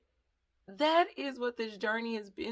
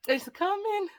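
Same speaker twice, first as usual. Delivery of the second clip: lightly done sister voice, much higher; a quick high mock of her sister; imitating my sister; 9 semitones higher, 5 dB louder than usual. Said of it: "that's supposed to be my sister".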